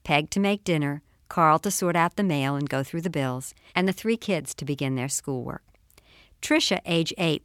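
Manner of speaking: ordinary delivery, nothing marked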